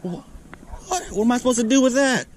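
high, fast, incredulous question; disbelieving. Real, not acted. Angler facing a fish too big to land, disbelieving.

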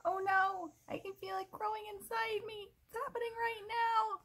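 high, frantic crocodile alarm; frightened crocodile. Acted. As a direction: squeal it like the seed is growing inside. Crocodile feels seed growing.

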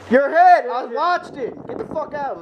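sharp yelled warning; shouting. Real, not acted. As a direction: yell it out to warn. Warning a teammate mid-firefight.

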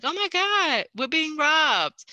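high-pitched, drawn-out retold cry of alarm; mock alarmed cry. Apartment viewer alerting everyone to a robber, mock alarm. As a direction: imitate someone suddenly realizing there's a robbery, stretching it out high.

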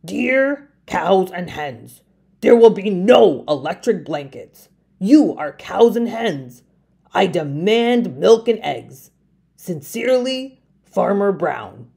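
a hint of a firm farmer reading; lightly voiced farmer writing a demand; stern farmer letter. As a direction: read the ultimatum firmly.

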